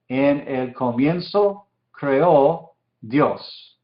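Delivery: very slow, with gaps between phrases; slow careful translation. Shown as a demonstration. Teacher translating the Hebrew verse for students, very slow and deliberate. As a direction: render it slowly, piece by piece.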